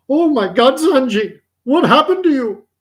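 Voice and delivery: high, loud cry of alarm; shocked grandfather. Acted. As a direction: exclaim in mock shock at your grandson. Acted shocked grandfather in joke.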